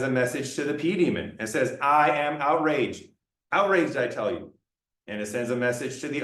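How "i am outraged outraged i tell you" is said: normal talking voice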